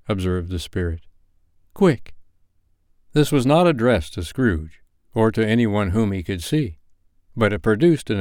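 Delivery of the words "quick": urgent command. A narrator's reading. Spirit commands.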